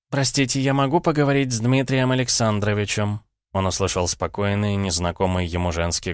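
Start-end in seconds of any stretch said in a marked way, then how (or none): 0.1-3.2 higher, courteous female caller; lightly voiced woman on the phone; polite young woman's voice. A narrator's reading.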